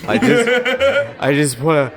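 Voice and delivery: high-pitched mock choking up; hammed-up tearful fan; about to cry. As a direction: act an overwhelmed fan breaking into tears. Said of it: "they just start crying".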